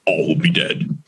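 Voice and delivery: low, husky trailer voice; ominous low announcer with dramatic pauses; movie trailer announcer. Pretend. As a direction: do a mock horror trailer voiceover. Improvised movie trailer for Death Knell.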